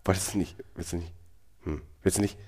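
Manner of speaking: ordinary talk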